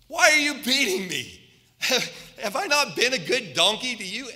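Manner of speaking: high, loud, squeaky donkey voice with a laugh; talking donkey. Acted. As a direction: play the wronged donkey, pleading and indignant. Donkey speaks in the Bible story.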